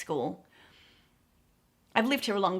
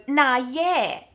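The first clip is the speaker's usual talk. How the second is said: "Nah, yeah" landing on a louder, falling "yeah"; agreeing nah yeah; 4 semitones higher, 6 dB louder than usual. Retold or imitated. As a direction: concede with a relieved nah yeah. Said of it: "you go".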